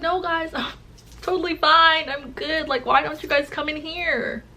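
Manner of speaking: high, put-on breezy voice; over-bright cover-up with a little laugh; feigned cheerfulness. She feigns being fine to friends.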